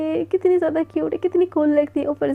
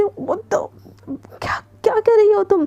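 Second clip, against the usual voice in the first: startled, breathless whisper, higher; shocked whisper; 6 semitones higher than usual. Acted. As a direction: gasp it out in a shocked hush. Anaya shocked, pushed down.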